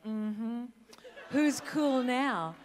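loud, drawn-out mock triumph; acted gloating. Bullied chess player getting her own back, gloating. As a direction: crow it slowly, playing the vindicated nerd. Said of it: "I was like".

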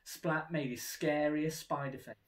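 plain reading voice

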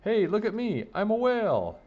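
high, loud excited call; showing off. A narrator's reading. Boy playing whale to his friends, calling out excitedly. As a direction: call for attention across the pool.

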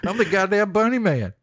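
high, hammed-up rural Virginia accent; Bunny Man. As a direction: proclaim it in a cartoon Virginia twang. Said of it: "saying that in that accent".

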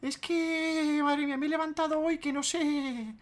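high whiny voice, complaining of tiredness; mock tired youtuber. Mocking a lazy youtuber's excuses, high whine. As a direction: whine like a drained streamer. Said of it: "A los cansaditos".

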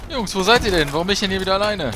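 loud, high, exasperated calls to teammates; frustrated shouting. Real, not acted. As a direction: yell out, annoyed at being left alone. Alone again, calling teammates.

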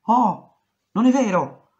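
put-on angry paranoid yell at the girl; shouting. A narrator's reading. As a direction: yell it at her, outraged. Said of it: "urlò".

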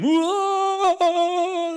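long, high sustained wail; imitated loud wail. Imitating the waiter walking to the kitchen, loud wailing. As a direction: howl one high note, loud and drawn out. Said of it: "you hear him the whole way".

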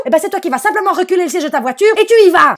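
high, loud, fast final command; wife laying down the law; triumphant order. Retold or imitated. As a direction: build up and slam the ending.

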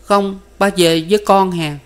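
put-on high, pleading toddler; small child's voice. A narrator's reading. Child insists father come home, small child's voice. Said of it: "Hai vợ chồng nghe con nói".

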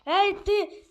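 angry shout. Acted. Confronting the monster.

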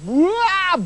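high comic "waah" of falling; falling yell. A narrator's reading. Prince falls out of the tower window, high comic yell. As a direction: wail as if tumbling backwards.

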